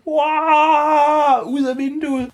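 high thrilled exclamation; excited wow. Acted. Flying out the window, high thrilled cry. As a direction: cry out as the bird takes off.

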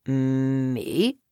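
very low, soft frog; deep, understated reply. A narrator's reading. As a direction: drop deep and quiet for the frog. Frog answers the startled girl.

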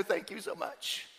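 very soft, slow gratitude; meek relieved thanks. Retold or imitated. First grader spared a spanking thanks the principal, meek and hushed. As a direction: imitate a small boy's hushed thanks.

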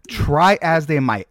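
usual speaking voice

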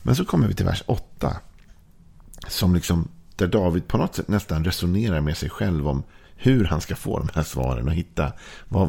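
ordinary talk, nothing marked

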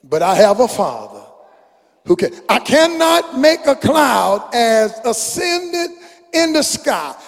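rising, soaring preacher chant of the lyrics; preaching a song. Acted. Preacher reciting a song to the congregation, soaring chant. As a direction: build it up, let it swell.

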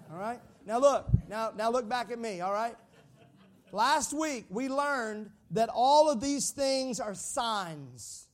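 usual speaking voice

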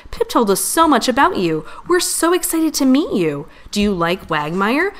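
high, eager string of questions; thrilled chatter, each question lifting high; easily excited. A narrator's reading. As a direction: gush with questions, voice leaping up. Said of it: "Scout gets excited easily".

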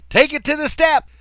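high, loud shout across a river; yelled. Retold or imitated. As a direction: yell the order out. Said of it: "I yelled".

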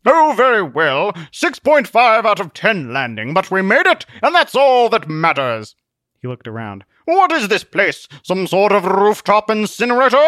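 unbothered, lofty knight; pompous hero voice. A narrator's reading. Zealot shrugs off crash.

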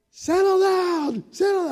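put-on high, loud call over noisy kids; loud leader. Gym leader calling to rowdy kids, high and loud. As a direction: strain to be heard over the gym. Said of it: "it was loud in there".

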